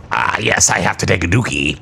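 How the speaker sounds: low, smug villain voice; a dopey villain going low; supervillain voice. Retold or imitated. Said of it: "the supervillain sounds like".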